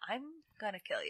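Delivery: very quiet, quick aside; muttered threat. Retold or imitated. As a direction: imitate a threat muttered under the breath. Retold threat under pet names.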